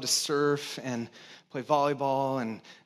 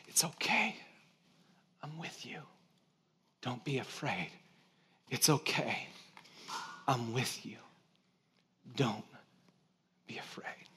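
soft unvoiced whisper, reassuring; whispered comfort; 5 semitones lower, 11 dB quieter than usual. Father whispering to his scared daughter on a paddleboard, gentle whisper. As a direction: whisper it close in her ear. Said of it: "what he's saying is".

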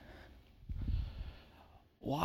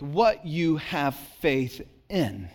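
normal talking voice

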